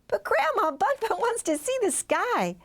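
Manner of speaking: put-on high child voice, begging; little girl pleading with grandma, high and wheedling. A narrator's reading. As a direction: coax grandma like a small child. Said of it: "Paige pleaded".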